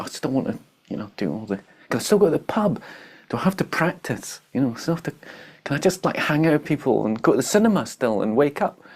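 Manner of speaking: whiny inner voice. Retold or imitated. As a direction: play the spoiled ego begging to keep its fun. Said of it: "that whiny, bitchy, ungrateful voice".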